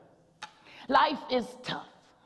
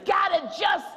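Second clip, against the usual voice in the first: full-force preaching; 4 semitones higher than usual. Acted. Preacher urging the church to keep going, full-force preaching. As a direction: preach it hard to the congregation.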